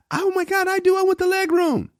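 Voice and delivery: high falsetto mock of an eager lady; excited lady. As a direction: squeal it like she just won the lottery. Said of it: "This lady said".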